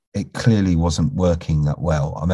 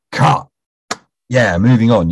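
brisk, satisfied call of cut, louder; upbeat cut; 5 semitones higher, 6 dB louder, slower than usual. Retold or imitated. Director pleased with the take, calls a bright cut. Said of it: "if it's a good take".

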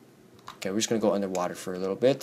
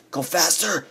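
higher, strained urging at normal volume; urgent urging; 6 semitones higher than usual. Real, not acted. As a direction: urge it on, pitched up. Urging himself on while fleeing.

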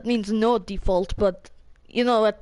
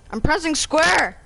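frustrated; 6 semitones higher than usual. Real, not acted. Trap won't place in game.

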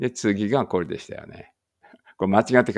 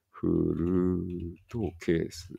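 slow katakana reading; 3 semitones lower, 6 dB quieter, much slower than usual. Shown as a demonstration. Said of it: "これなんていう？".

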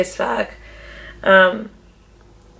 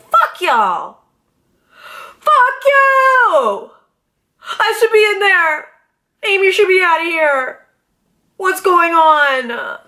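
higher, louder hammed-up tearful yelling; slow, drawn-out mock sob-shout; mock crying yell; 10 semitones higher, 3 dB louder, much slower than usual. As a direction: wail at them from outside the house. Retold tearful outburst at housemates.

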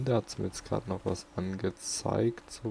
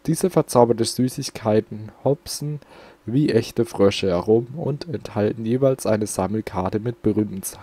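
louder, announcing read of the item text; reading aloud; 10 dB louder than usual. Acted. Reading the game's item text to viewers, announcer style. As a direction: read the card out like a presenter.

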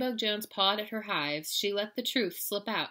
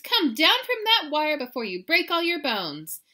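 loud, high alarmed plea; mother's wail; 9 semitones higher, 9 dB louder than usual. A narrator's reading. Mama begs kitty off the wire, loud wailing. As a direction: call up urgently, pitched high. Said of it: "wailed Mama Junebug Jones".

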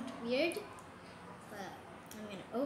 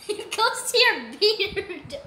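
loud teasing; 5 semitones higher, 13 dB louder, slower than usual. Real, not acted. Loud slow teasing joke.

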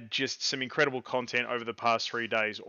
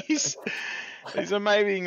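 laughing; 7 semitones higher than usual. Real, not acted. Laughing while describing memes.

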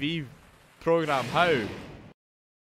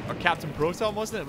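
ordinary delivery

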